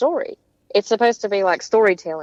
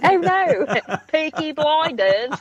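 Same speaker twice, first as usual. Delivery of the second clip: attempted British accent; 6 semitones higher, slower than usual. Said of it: "I've been going to Australian".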